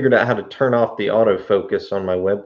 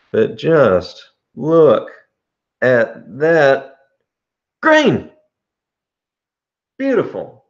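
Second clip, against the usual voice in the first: awestruck; 4 semitones higher, 4 dB louder, much slower than usual. Real, not acted. Showing off the pipe grain.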